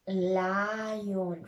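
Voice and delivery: very slow This is lion; teacher model sentence. Shown as a demonstration. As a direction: stretch each word for kids to copy. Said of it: "Repeat after me".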